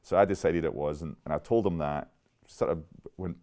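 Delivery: ordinary talk, nothing marked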